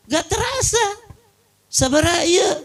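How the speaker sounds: light high imitation of his wife; wife's voice. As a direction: chirp it like the carefree wife. Wife's view of spending.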